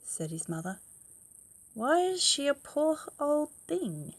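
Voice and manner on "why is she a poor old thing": put-on child's voice. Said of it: "asked Wilfred Gordon".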